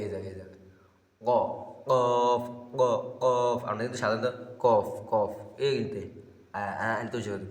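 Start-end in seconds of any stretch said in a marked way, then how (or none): 1.2-3.6 কফ struck hard and loud from the back of the tongue; qaf from tongue root. Shown as a demonstration. Teacher loudly demonstrates কফ from the tongue root. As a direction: hit কফ firmly at the tongue root. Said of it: "জিহ্বার গোড়া এই জায়গায়".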